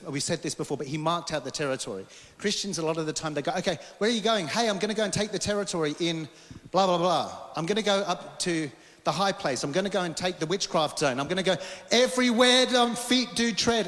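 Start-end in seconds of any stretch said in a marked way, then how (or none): 3.5-13.9 raised, bold voice of an overeager believer. Acted. Acting out overeager Christians' boasts.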